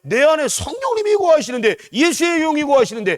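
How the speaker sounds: very high, loud, ringing proclamation; climactic preaching. Real, not acted. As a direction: cry out the faith at full force.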